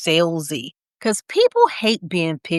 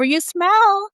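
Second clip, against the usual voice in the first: higher, rising smiley tone; audible smile; 12 semitones higher than usual. Shown as a demonstration. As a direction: let the smile lift the pitch. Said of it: "it changes the tone of your voice".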